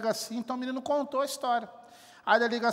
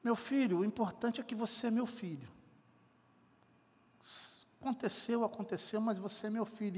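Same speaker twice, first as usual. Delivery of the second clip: soft, tender fatherly reassurance, quieter and unhurried; 7 dB quieter than usual. Retold or imitated. Father embracing his runaway son, soft and tender. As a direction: hold the son close and say it softly. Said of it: "abraça ele e fala assim".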